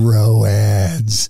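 Roads drawn out low and loud; Roads, low and dragged out, stoner style; stoned roads. Retold or imitated. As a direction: stretch the word Roads into two slow syllables. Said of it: "they were talking about roads".

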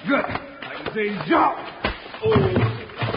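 slow high loud yell; straining shout. Acted. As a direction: strain against a heavy blow. Fight in torchlight.

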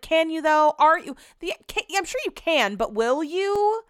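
pointed teasing question, the last words stretched slow; skeptical challenge. Retold or imitated. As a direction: needle him with mock doubt. Doubting husband's promise, retold.

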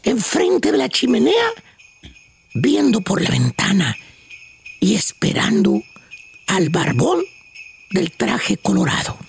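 slow, low meditation voice; guided meditation. Acted. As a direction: lead a calm Christmas visualization slowly. Leads a mock meditation.

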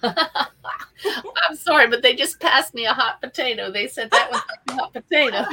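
real giggles breaking in; laughing through words. Real, not acted. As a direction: laugh while apologizing. Laughs at the guides' hot potato.

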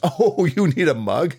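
high, slow, incredulous wife voice; mock surprise. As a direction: act amazed he would even ask. Wife feigning surprise at giant.

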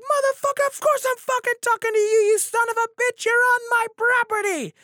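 high, loud imitation of her furious tirade; enraged drunk landowner yelling; screaming stranger. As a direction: screech it at the top of your voice. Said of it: "screaming at the top of her lungs".

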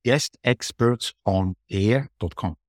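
slow, spaced-out web address; careful dictation. Real, not acted. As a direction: dictate it slowly, word by word.

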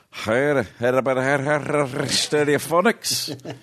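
put-on a tipsy Scottish litter worker greeting a rock star, in a Scottish accent; drunk Scottish accent. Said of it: "as thick a Scottish accent as I've ever heard".

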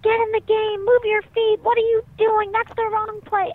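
high, loud mock berating; imitated sideline screaming. Parents berating young kids from the sidelines, mock screaming. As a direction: yell orders like an overbearing soccer parent. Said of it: "where they're screaming".

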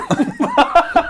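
high-pitched burst of laughter. Real, not acted. Amused at the not-my-mother line, a high laugh.

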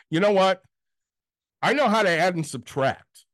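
high, loud snarky comeback; snarky retort. Retold or imitated. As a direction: snap back with pointed sarcasm. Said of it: "I got really fucking snarky".

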